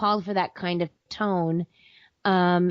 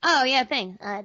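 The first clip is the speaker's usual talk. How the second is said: normal talking voice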